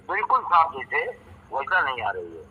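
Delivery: patchy, fading call audio; radio breaking up. Real, not acted. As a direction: voice dropping out on a bad phone line. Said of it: "आवाज़ साफ़ नहीं आ रही आपकी".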